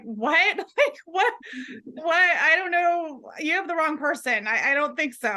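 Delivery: shocked disbelief. Retold or imitated. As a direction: replay the stunned "who, me?".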